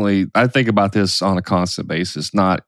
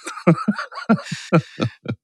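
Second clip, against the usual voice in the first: laughing; 9 semitones higher than usual. Real, not acted. Laugh during drill voice.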